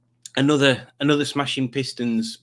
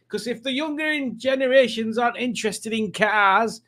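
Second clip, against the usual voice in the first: put-on old car enthusiast; 9 semitones higher than usual. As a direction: play a fusty old motoring buff chatting to Ernest. Old car enthusiast talking to Ernest.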